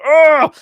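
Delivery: loud high disgusted groan; disgusted ugh. Retold or imitated. Mocking the awful character with a loud ugh. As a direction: spit out a revolted ugh.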